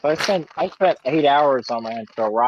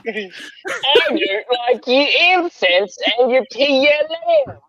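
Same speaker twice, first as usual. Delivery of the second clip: high-pitched mock Cockney; hammed-up Cockney villain voice; Grinch with Cockney accent; 10 semitones higher than usual. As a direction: whine it up high in caricature Cockney. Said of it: "like, Cockney accent".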